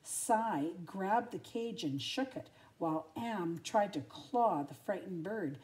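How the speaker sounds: plain reading voice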